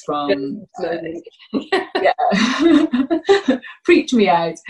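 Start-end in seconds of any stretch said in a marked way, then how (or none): 1.5-4.6 laughing in disbelief. Real, not acted. Guest recalls the sniffer dog email, laughing. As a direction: laugh it off, still amazed.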